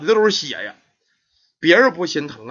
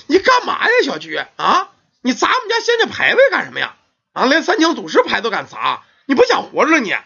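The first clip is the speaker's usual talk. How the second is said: shocked angry aunt; 7 semitones higher than usual. Acted. Aunt catching her niece smashing the shrine, shocked and furious. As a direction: shriek at her in outrage.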